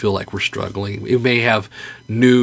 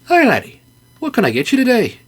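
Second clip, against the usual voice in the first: much higher, loud and quick barkeep; hammed-up high bartender voice; cheery bartender; 13 semitones higher, 3 dB louder than usual. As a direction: greet the bear with bright Scottish-ish cheer.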